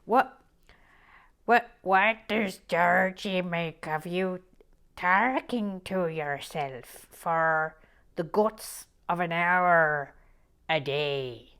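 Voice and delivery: put-on slow, loud Irish-accented question; Leo Varadkar accent. Quoting Noreen's question in a slow, loud Leo Varadkar accent. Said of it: "a little bit Leo Varadkar".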